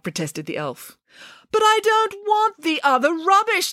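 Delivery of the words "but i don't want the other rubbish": indignant. Acted. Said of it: "declared Nanny Piggins".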